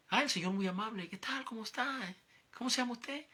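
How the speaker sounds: high, bright mock-polite greeting voice; friendly greeting. Narrator imitating the man greeting him, high and friendly. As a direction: voice the man's overly friendly hello.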